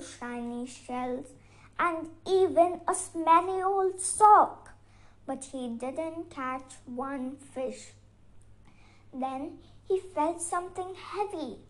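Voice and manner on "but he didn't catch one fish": plain reading voice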